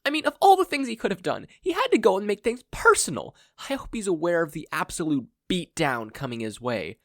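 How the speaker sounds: high, loud, fast ranting; angry rant that ignores the interruption; rapid furious rant. Acted. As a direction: steamroll on without stopping. Izuku keeps ranting over mentor.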